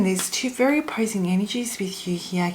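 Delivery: ordinary talk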